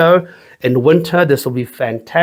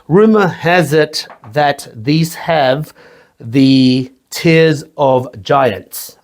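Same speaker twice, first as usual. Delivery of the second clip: usual speaking voice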